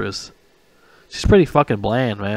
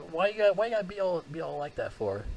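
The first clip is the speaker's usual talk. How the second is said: high, fast whine; whiny complaint; a fast whiny coach, high and soft; whiny assistant coach; 7 semitones higher, 11 dB quieter than usual. Retold or imitated. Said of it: "like, whiny".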